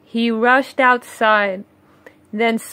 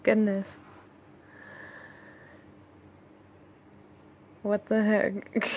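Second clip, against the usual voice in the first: softer, half laughing comment; soft amused aside; 12 dB quieter than usual. Real, not acted. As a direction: react quietly in disbelief.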